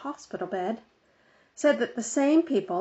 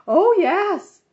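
high, loud bossy lady's voice; fussy Mrs Armstrong; 10 semitones higher, 9 dB louder than usual. A narrator's reading. Busybody on the phone lecturing Mother, high and loud. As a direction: play the busybody organizer insisting.

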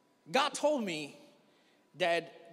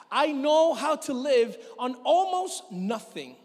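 scripture reading; 5 semitones higher, 5 dB louder than usual. Acted. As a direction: proclaim the verse, louder and higher. Reads scripture aloud.

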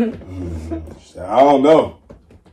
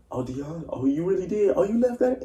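rising higher, teasing voice; mocking relative; 11 semitones higher than usual. Relative teasing Dion about the burnt hot dog, mock high. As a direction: needle him like a nosy relative. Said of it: "I hear somebody else in the house say".

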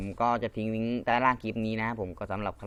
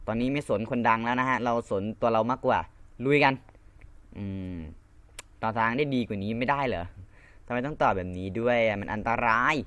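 ordinary talk, nothing marked

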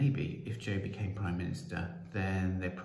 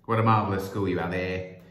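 big, loud public greeting; shouted; 9 dB louder than usual. Acted. Prime Minister greeting the headmaster before the crowd, shouted. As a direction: boom it out to the headmaster. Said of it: "he shouted".

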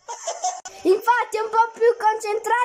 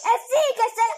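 ordinary delivery

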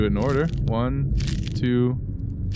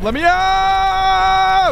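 long, slow, very high yell; desperate scream; 5 dB louder, much slower than usual. Real, not acted. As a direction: scream for release as water rises. Trapped as water rises.

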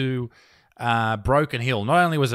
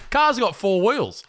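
slow, high sarcastic quip; mocking the attendant's logic, drawn out; sarcastic retort; 6 semitones higher, slower than usual. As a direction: say it like it's obvious.

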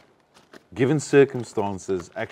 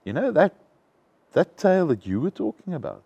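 raised pitch demo; 8 semitones higher, 4 dB louder than usual. Shown as a demonstration. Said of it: "if you go".

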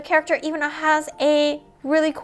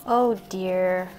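ordinary delivery

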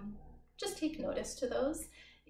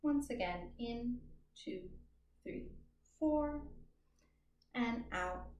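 pace the group's breathing gently; counting breaths. Acted. Counts a paced breathing exercise.

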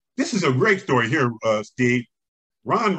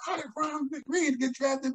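much higher, slow, mock baffled question; puzzled rival coaches; 13 semitones higher, 6 dB quieter, slower than usual. Rival coaches wondering about the draft pick, mock baffled. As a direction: play the bewildered coaches. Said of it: "everybody said".

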